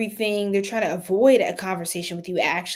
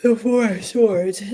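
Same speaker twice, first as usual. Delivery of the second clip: yawning; 7 dB louder than usual. Real, not acted. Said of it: "I'm yawning as I pulled".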